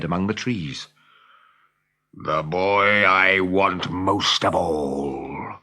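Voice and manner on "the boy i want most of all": low, slow menacing pirate captain; growling Hook. Acted. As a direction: growl it like a villain savoring revenge. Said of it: "growled Hook".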